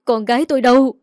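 loud, desperate shout of "Con gái tôi đâu"; distraught mother demanding her daughter; frantic cry. A narrator's reading. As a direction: cry out as if losing your voice. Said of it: "Bà nói là cả giọng đi".

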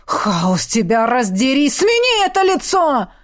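enraged outburst rising sharply in pitch; furious cry. A narrator's reading. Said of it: "выкрикнул он".

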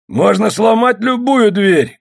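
high, slow shout through a door; drawn-out, high-pitched yell. A narrator's reading. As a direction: shout a threat at someone shut inside. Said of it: "крикнул ей Полос".

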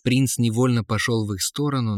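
ordinary delivery

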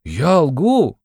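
high, playful protest; teasingly worked-up retort; heated mock indignation. A narrator's reading. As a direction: leap in as if affronted. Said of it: "подхватил Порфирий, по-видимому, горячась".

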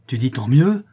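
loud, rising challenge; menacing exclamation. A narrator's reading. La louve challenges Fleur de Marie's answer, menacing exclamation. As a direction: throw it back as a threatening question. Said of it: "S'écria la louve, les yeux menaçants".

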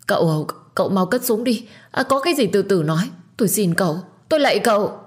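high, loud desperate begging; frantic pleading. A narrator's reading. Terrified stepmother begs the gunman for mercy. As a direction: grovel for your life, words tumbling out. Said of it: "lạy Hưng rối rít".

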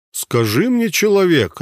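high, loud commanding deity; god's voice. A narrator's reading. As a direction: speak as a mighty god inside his head. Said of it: "раздался в голове Гатока голос Бора". (голос божества)

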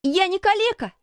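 put-on high-pitched furious boy; boy's high, angry outburst; furious cry. A narrator's reading. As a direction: cry out in outrage, pitch right up. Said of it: "с яростью закричал мальчик".

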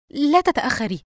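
high, loud, anxious plea; tense. A narrator's reading. Yusra tells Dina to hurry, tense. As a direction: urge her nervously not to be late. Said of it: "يسرى بتوتر".

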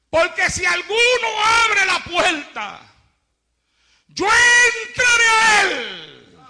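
loud, high, crying plea, slow; Christ pleading. Acted. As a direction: beg them to open the door, almost in tears.

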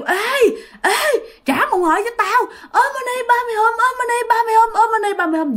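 high shouted demands and chant; yelling while chasing. Retold or imitated. Thi chasing the demon, shouting and chanting high. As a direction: yell after it, then chant frantically. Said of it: "Thi vừa la".

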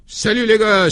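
loud, high breezy greeting; bursting into the office, a loud high hello; cheerful exclamation. Acted. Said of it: "s'écria-t-il en pénétrant en coup de vent".

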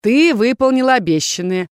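loud mock comfort, rising in pitch; gloating villain leader. Rebel leader threatening the heroine, triumphant. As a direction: console falsely, then exult.

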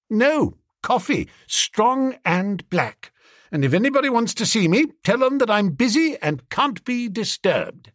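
high-pitched, self-important instructions; huffy orders. Acted. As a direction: give orders in high dudgeon. Bertie huffily orders Jeeves about.

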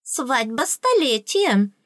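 slow, high, pompous fairy voice; self-important. A narrator's reading. Tooth fairy explaining her state to the princess, self-important. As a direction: announce it grandly, drawing it out high. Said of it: "важно сказала зубная фея".

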